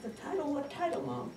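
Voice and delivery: high, soft questioning; puzzled daughter. Acted. Daughter asking her mother about the title, puzzled. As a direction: ask Mom in a high, puzzled voice. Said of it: "I said".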